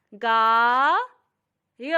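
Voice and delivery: गाय stretched very slowly, the आ held long. Shown as a demonstration. Teacher demonstrating long vowel to children, very slow. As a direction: drag out गाय syllable by syllable. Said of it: "लंबा करके बोलना होता है".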